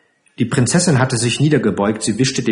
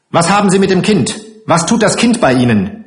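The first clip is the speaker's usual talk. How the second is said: fast, raised, accusing questions; indignant demand; 7 semitones higher, 4 dB louder, faster than usual. Acted. Matron confronts strangers over the child, indignant and fast. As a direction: fire off the questions sharply.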